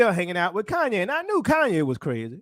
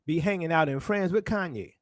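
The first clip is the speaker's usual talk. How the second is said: usual speaking voice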